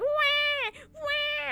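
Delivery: high imitated cat wail; screaming cat. Owner imitates her cat answering her apology, screaming. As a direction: yowl back, high and insistent. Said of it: "He's like".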